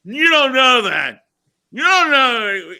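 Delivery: high, loud, slow argument; loud indignant argument; slow shouted protest. Acted. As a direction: plead loudly and slowly. Acted scene quarrel.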